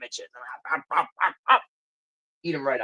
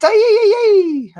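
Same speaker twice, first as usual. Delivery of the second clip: mock Mexican accent; 10 dB louder, slower than usual. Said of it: "that was Mexican".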